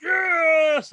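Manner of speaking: high, loud "Yes"; excited yes. Retold or imitated. Walker confirms it was him, high excited yes. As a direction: confirm it with a triumphant yell. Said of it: "And I was like".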